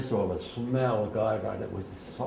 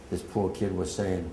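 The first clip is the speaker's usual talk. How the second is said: normal talking voice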